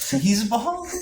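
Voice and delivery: high incredulous question; surprised. Real, not acted. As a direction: cheese balls, really?. Incredulous echo of label.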